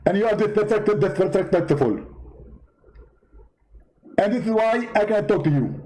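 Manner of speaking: high, indignant preacher voice; Zakir Naik impression. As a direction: play the offended debater, pitched well up. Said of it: "Zakir Naik?".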